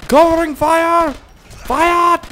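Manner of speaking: high, loud yell of "Covering fire!"; battle cry. Pretend. Gamer yelling covering fire to his squad, a high battle shout. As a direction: bellow it like a soldier under fire. Calling out in firefight.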